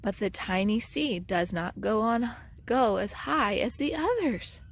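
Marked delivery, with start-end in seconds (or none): none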